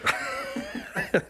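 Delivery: high, breathy laugh; burst of laughter. Real, not acted. Telling the story, breaking into a high laugh.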